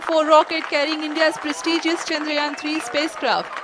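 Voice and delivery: excited. Real, not acted. Live rocket lift-off commentary.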